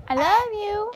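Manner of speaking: high, lightly sung; teaching phrase. Shown as a demonstration. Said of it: "Ngày nào mình cũng học cái câu này hết".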